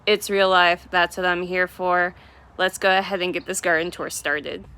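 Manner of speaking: ordinary talk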